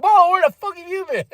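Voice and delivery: high, startled cry of disbelief; shocked outburst. Retold or imitated. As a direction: blurt it out in shock. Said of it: "I was like".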